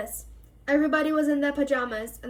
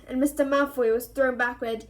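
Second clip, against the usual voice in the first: ordinary delivery, nothing marked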